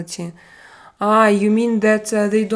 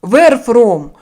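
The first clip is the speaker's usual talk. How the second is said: curt, blunt demand, much louder and higher; rude viewer; 3 semitones higher, 12 dB louder, slower than usual. Retold or imitated. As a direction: bark it like a rude stranger in chat. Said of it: "they come and they say".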